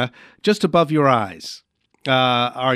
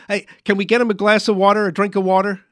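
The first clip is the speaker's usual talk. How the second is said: fast, higher call for help; urgent alarm; 6 semitones higher, 4 dB louder, faster than usual. Pretend. Host calling for water for a choking mime, urgent. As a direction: hurry out the request, pitch raised.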